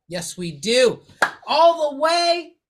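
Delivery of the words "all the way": drawn-out high ring announcer call. Acted. Announcing guest like ring intro.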